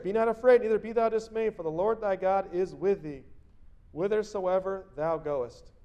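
loud, high, proclaiming Bible read; scripture reading. Acted. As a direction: declaim the verse to the congregation. Preacher reads scripture aloud.

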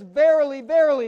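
"Verily, verily" called out loud, each one falling; ringing proclamation. Real, not acted. Preacher quoting Jesus to the congregation, loud and solemn. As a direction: ring it out like scripture.

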